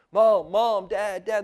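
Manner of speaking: high, loud panicked cries for mom and dad; frantic child calling. Retold or imitated. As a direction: call out like a lost, scared kid. Lost child calling for parents.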